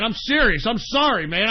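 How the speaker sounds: ordinary delivery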